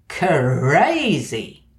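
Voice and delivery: crazy said with an extra uh inside, stretched for drama; dramatic drawn-out word. Shown as a demonstration. Lecturer showing the dramatic extra schwa, stretched crazy. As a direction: drag crazy out as cuh-razy. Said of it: "insertion of a schwa ... for dramatic effect".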